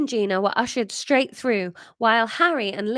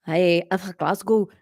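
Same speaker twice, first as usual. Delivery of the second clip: put-on deeper Glasgow accent; Scottish accent; 3 semitones lower than usual. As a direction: try on a Glaswegian voice. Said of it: "no, she was Scottish".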